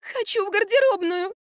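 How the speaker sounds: put-on high childish whine; whining. A narrator's reading. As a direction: whimper it like a spoiled child. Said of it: "захныкала Марфа".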